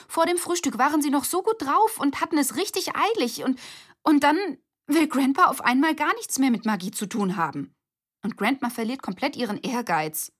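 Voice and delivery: high, loud, exasperated rant; upset girl pouring out her frustration; indignant outburst. A narrator's reading. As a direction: huff out the injustice. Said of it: "Sie schnaubte empört".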